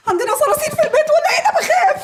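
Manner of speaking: high, loud, fast laughing shriek; laughing panic. Acted. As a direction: squeal in mock fear of cockroaches. Panicking at raised slipper.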